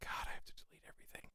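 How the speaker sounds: quick frustrated whisper under breath. Real, not acted. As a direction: whisper it to yourself, fast.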